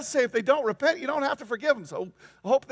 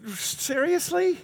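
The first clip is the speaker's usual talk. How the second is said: incredulous; 7 semitones higher, 3 dB louder, much slower than usual. Acted. Preacher reacting to Jesus' command.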